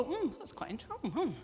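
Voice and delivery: intrigued listener. Retold or imitated. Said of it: "so that people go".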